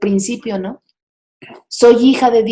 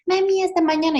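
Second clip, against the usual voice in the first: put-on innocent child voice; 8 semitones higher, 4 dB quieter than usual. Son says goodnight as if nothing happened.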